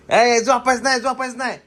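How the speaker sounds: high, loud no-sleeping order; loud scolding. Real, not acted. Mother scolding kids, loud no sleeping order. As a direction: snap them awake.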